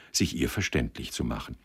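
usual speaking voice

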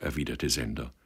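usual speaking voice

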